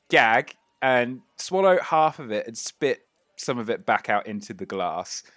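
ordinary talk, nothing marked

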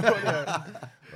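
high, loud burst of laughter. Real, not acted. Cracking up with the host over a botched company name, laughter.

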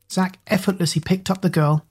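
ordinary delivery, nothing marked

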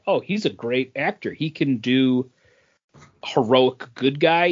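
ordinary talk

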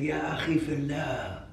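put-on slow, drawn-out elderly woman's address; old sheikha voice. Old woman addressing the imam after Jumu'ah, sheikha voice. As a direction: imitate an old sheikha, stretching the words. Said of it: "She has a sheikha voice".

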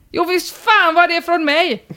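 high, emphatic hammed-up holler; mock shout. Poster confirms the present came from him, mock shout. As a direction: proudly holler a confession in mock yell. Retold calling out from a window.

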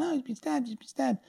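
high, alarmed crowd cry of panic; panicked shout. Retold or imitated. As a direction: call out in shock that someone's been stabbed. Crowd panicking over a stabbing.

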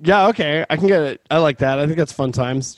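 ordinary talk, nothing marked

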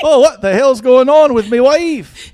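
exaggerated Scottish joke voice; Scottish accent. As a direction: drag out a hammy Scottish punchline. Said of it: "street jokes with Scottish accents".

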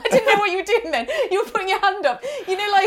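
high giggling chatter; laughing. Real, not acted. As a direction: talk through a fit of giggles. Laughing at co-host's gesture.

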